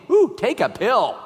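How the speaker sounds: playful mock panic over a small ache; mock pill-popper. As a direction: ham up the reflex to grab a pill. Mocking pill-popping reflex.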